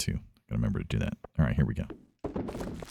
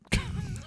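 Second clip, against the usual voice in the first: short laugh; 7 dB quieter than usual. Real, not acted. Laughs at own joke.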